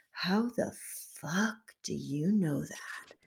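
hushed, drawn-out mock astonishment; retold shock. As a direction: imitate someone dumbfounded, drawing every word out. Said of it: "he's so shocked".

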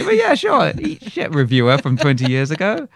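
loud, high mock taunt; mock gloating. Actor taunting a reviewer from years ago, mock-gloating shout. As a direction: crow it playfully at an old critic.

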